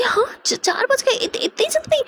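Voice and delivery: high, stammering disbelief; can't believe it's already four; shocked disbelief. Acted. Girlfriend shocked at the time.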